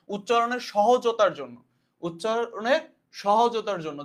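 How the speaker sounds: slow, deliberate dictation pace. Shown as a demonstration. Teacher dictating a term to students, slow. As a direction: spell it out slowly for note-taking. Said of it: "teacher dictating a key phrase".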